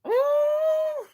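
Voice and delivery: high, sustained teasing ooh; long high-pitched reaction hoot; drawn-out ooh. Real, not acted. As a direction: hold a high hooting "ooh". Reacting to the man's flirting.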